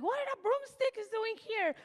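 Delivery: put-on high child voice, amazed; high, wondering kid voice; excited grandson. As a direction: play the little grandson finding a broomstick.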